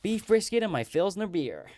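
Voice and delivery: American accent. Shown as a demonstration. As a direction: put on a big Texan voice. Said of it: "do it in my American accent".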